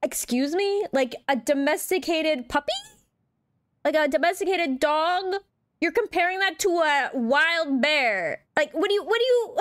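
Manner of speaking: high, outraged disbelief; can't believe what she just heard; incredulous. Real, not acted. Incredulous at a woman's question.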